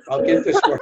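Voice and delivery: laughs it out up high; breaking into laughter at the punchline; laughing. Real, not acted. Finishing the whiskey story to a host, laughing.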